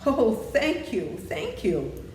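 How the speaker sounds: overjoyed Scarecrow crying thanks; cried out. A narrator's reading. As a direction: gush out the thanks like a joyful straw man. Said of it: "cried the Scarecrow".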